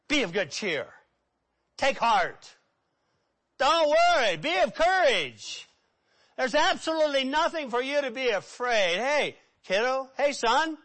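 rising into bright encouragement; reassuring Jesus. Pretend. Jesus cheering up the frightened paralyzed man, reassuring. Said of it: "that's the way I would say it".

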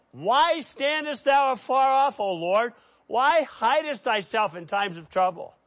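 high, loud plea; crying out to God. Acted. David pleading with a distant God, crying out. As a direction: raise the voice in an acted lament. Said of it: "who cried out to God".

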